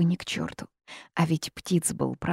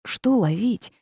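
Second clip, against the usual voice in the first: usual speaking voice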